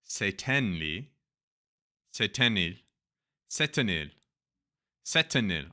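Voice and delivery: Setenil in a Turkish accent. Shown as a demonstration. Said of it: "I'm saying it with a Turkish accent".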